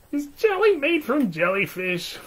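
squeaky high curious frog; high little frog voice. Acted. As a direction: ask like a tiny wide-eyed frog. Small frog character asks.

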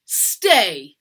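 loud, commanding bark; sharp, forceful command; stern order. A narrator's reading. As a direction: order them with full authority. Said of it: "Madam ordered".

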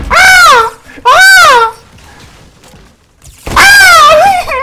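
high, loud real scream; panicked scream. Real, not acted. Gamer screaming as the enemy rushes him, panicked.

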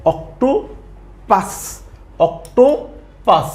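Octopus stretched very slowly, syllable by syllable; slowly drawn out. Shown as a demonstration. Teacher stretching octopus for learners. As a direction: draw out octopus slowly and clearly.

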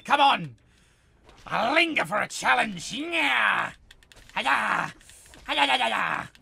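loud fighting yells while swinging; battle cries. Real, not acted. Player yelling battle cries at raiders, loud. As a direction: roar the attack, throwing every swing.

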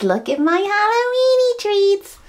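squeaky high cutesy voice; sing-song high and slow; high silly voice. Pretend. Playful repeat of the line.

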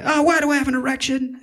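startled. Acted. The meditator blurting out a sudden alarmed discovery. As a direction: caught off guard mid-calm.

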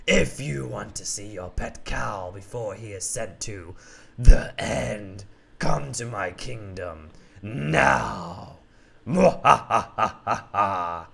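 slow, low menacing lion; villain lion voice. Acted. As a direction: threaten slowly, then cackle. Villain's note continues.

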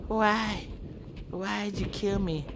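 high, pleading dying voice; begging victim. Acted. Dying stepbrother begging his brother, high and pleading. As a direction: plead for your life in a thin high voice.

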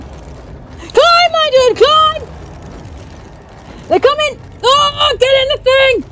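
very high, loud shrieks; shrill terror at top pitch; panicked screaming. Real, not acted. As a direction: scream orders in a real panic. Screaming at character during chase.

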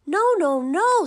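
wolves cry out high and loud; high loud refusal. A narrator's reading. Little wolves refusing the pig at the door, high loud cry. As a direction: shout no three times, high.

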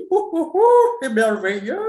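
mocking an author thrilled by nothing; mock delight. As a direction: fake cooing over the book going online.

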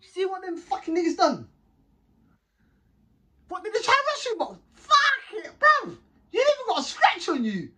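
fired-up outrage; retold hype. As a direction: hype up the friend after the fight. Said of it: "I'm like this".

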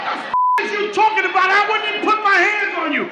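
high-pitched, rapid acted outburst; indignant protest. Accused man to his woman, high indignant protest.

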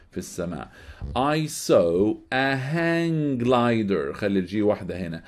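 ordinary delivery

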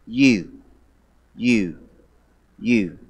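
You said low and soft, several times in a row; stress on you. Shown as a demonstration. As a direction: lean quietly on each You. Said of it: "Underline the word you".

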